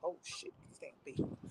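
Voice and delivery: barely voiced, under the breath; hushed, sinking-in dismay; muttered realization. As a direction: curse softly to yourself. Retelling realization while being arrested.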